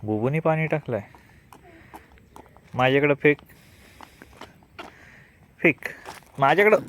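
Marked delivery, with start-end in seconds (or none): 2.7-6.8 calling out. Real, not acted. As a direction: wave for the ball from across the yard. Calling for the ball.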